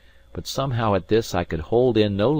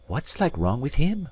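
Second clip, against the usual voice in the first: lightly voiced Scots lass; curious serving girl, rising; young maid voice; 8 semitones higher than usual. Maid's voice in story.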